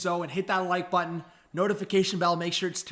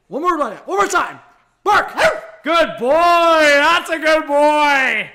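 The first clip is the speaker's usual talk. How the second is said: lavish the dog with praise; 7 semitones higher, 11 dB louder, slower than usual. Real, not acted. Streamer praising his dog for barking, high loud slow praise.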